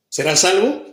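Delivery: normal talking voice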